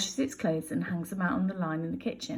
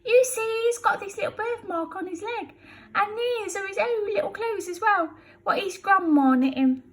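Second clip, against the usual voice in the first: excited Betty; 13 semitones higher, 5 dB louder than usual. Acted. Betty with found baby.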